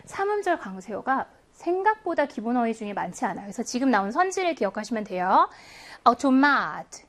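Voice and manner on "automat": Automat with a long, stressed MAT; third-syllable stress. Shown as a demonstration. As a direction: model Automat, landing firmly on the last syllable. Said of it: "삼 음절의 강세 있어요".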